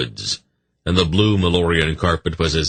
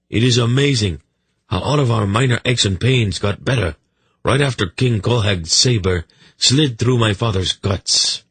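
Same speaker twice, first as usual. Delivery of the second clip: higher, animated royal voice; lively, higher-voiced monarch with mock amazement; wry king; 6 semitones higher than usual. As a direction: play a king drily marvelling.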